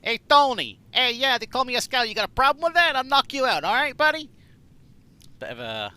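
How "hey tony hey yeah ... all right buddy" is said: loud, high, brash Brooklyn tough guy; tough New York Scout. Retold or imitated. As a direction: bark it like a cocky New York kid. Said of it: "That was meant to be a scout".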